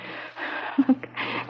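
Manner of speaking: soft unvoiced laugh; a breathless little laugh; nervous huff of a laugh; breathy nervous laugh.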